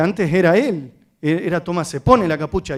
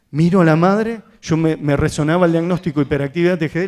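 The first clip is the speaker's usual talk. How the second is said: ordinary talk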